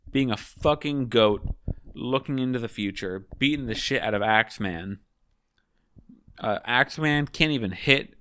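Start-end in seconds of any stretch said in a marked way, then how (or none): none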